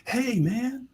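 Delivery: high-pitched mock awed hello; starstruck greeting. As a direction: imitate a rock star greeting his hero. Imitates star greeting supposed champion.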